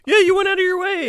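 very high, loud squawk; mock yell. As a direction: holler it in pretend indignation. Acted outburst mocking the angry guy.

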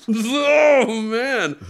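loud, high burst of bliss; overwhelmed exclamation. Retold or imitated. Man reliving the sun's overwhelming warmth, blissful outburst. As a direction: cry out as warmth floods you.

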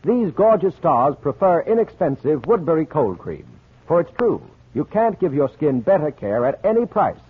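ordinary talk

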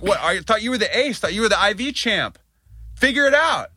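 high, sarcastic jab; retold dad taunting the nervous IV tech; mocking taunt. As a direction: needle him with mock disbelief.

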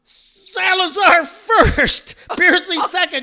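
long, high, loud announcement; re-enacting a climactic race call; shouted result. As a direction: stretch out the winner's name at full voice. Retold shout of the final result.

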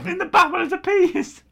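high squeal of amused disbelief; laughing disbelief. Real, not acted. Laughing at a photo of Fred in a bath. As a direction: squeal it out in giggles.

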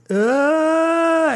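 long, high held "aah"; drawn-out cartoon scream; mock scream. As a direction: imitate passengers about to crash, one sustained high note. Imitates passengers screaming before crash.

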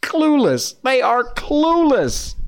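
high, drawn-out mocking singsong. Real, not acted. Host ridiculing the brewer's feeble excuse, high mocking. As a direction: jeer it way up high, stretching the word.

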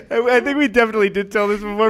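fast, high, still laughing. Real, not acted. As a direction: talk through leftover laughter.

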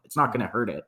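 ordinary talk

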